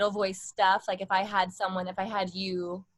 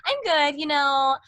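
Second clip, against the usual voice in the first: slow, high Barbie-ish "Hey, I'm good"; 4 semitones higher, 5 dB louder, much slower than usual. Shown as a demonstration. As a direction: play a sweet doll-like girl. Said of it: "a little Barbie-ish stuff".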